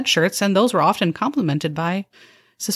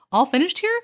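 high, chirpy server voice with a snide edge; a fake-cheerful waitress needling her customers; perky sarcastic waitress; 6 semitones higher than usual. A waitress sweetly insulting her diners, chirpy and high.

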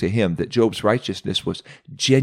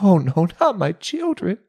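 Job's heart sinking at the news; anguished; 10 semitones higher than usual. Acted. Job, hearing of his children, cries out in anguish.

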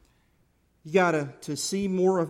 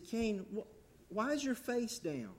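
gentle question; 3 semitones higher, 8 dB quieter, slower than usual. Acted. God gently asks Cain.

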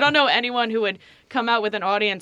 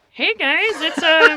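very high put-on comedian voice; Melissa Villaseñor; 10 semitones higher, 7 dB louder, slower than usual. Guest greets audience as her, stiff high impression. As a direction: greet the crowd in a high character voice. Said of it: "You know, she's like".

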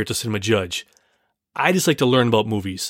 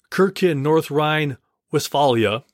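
normal talking voice

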